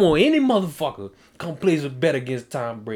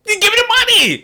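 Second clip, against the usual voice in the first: high, loud outburst demanding cash; outraged shout; 7 dB louder than usual. Real, not acted. Host demands the money from his friend, high outraged shout. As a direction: yell it much higher and louder.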